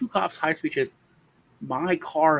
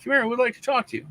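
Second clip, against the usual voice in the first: mock cop summons; 10 semitones higher, faster than usual. Cops summon him.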